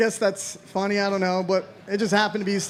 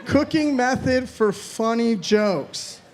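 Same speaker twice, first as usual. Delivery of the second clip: very slow, drawn-out read; slow stalling read; much slower than usual. Real, not acted. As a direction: drag each word out, stalling. Stalling over prompt.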